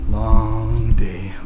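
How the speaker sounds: weary, breathy whisper; tired whisper. Real, not acted. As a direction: sigh it out, worn out. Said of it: "Oh, I'm tired".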